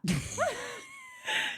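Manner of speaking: high squealing laugh of embarrassment; embarrassed laugh. Real, not acted. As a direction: burst into a high giggle at yourself. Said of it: "You're gonna laugh".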